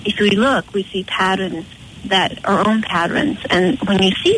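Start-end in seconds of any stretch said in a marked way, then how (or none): none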